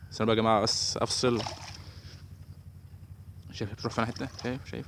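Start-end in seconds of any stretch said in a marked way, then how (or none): none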